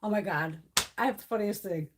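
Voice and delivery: normal talking voice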